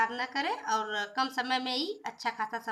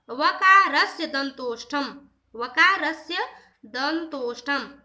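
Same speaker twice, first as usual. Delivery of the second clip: slow, louder chanting of the Sanskrit rule; sutra recitation; 6 dB louder, slower than usual. Shown as a demonstration. Teacher reciting the rule to students, slow and louder. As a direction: intone the sutra slowly for the students.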